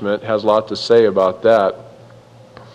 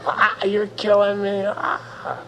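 higher, hammed-up fake crying; child wailing at a spanking, hammed-up and higher; mock wailing child; 8 semitones higher, 6 dB quieter, slower than usual. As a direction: whimper like a child faking pain. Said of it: "kids can manipulate their parents by crying".

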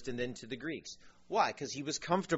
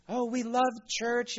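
high, slow, imitated gushing; mock weeping joy; 10 semitones higher, 4 dB louder than usual. People walking out of church, mock-weepy gushing.

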